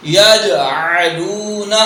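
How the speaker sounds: یجعلون with a throaty ain; slow یجعلون with a clear ain. Shown as a demonstration. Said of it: "جبکہ ہم نے پڑھنا ہے یہاں عین".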